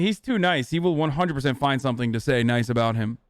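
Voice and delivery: ordinary talk, nothing marked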